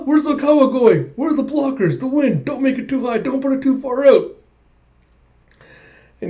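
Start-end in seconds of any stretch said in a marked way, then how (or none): 0.1-4.4 high-pitched frantic worrying; mock panic. Retelling Hinata's thoughts while setting, mock frantic panic. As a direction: rattle off each worry in a flustered squeal.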